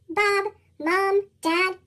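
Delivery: ordinary delivery, nothing marked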